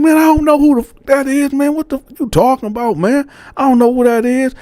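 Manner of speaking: retold frantic denial; heated denial. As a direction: deny it hotly. Denying it in the quarrel.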